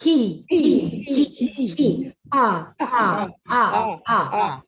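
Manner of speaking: short pushed he, ah, who on a four count; breath exercise syllables. Shown as a demonstration. Voice coach leading breath drills, punchy syllables. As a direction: pump each syllable from the diaphragm. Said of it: "let's do it one more time together".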